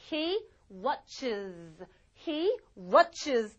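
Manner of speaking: watches said slowly with an extra "iz" syllable; added syllable ending. Shown as a demonstration. Teacher modelling he watches T V, watches slow with iz ending. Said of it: "is로 발음하세요".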